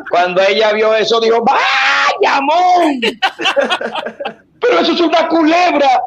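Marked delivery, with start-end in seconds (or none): 1.4-6.0 high astonished exclaiming; shocked woman. Retold or imitated. The woman reacting in amazement, a high mock-shocked cry.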